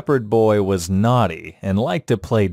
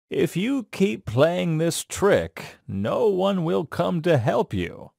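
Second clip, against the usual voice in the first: kindly; 6 semitones higher than usual. Acted. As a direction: gently warn the boy, taking your time. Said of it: "Then he kindly said".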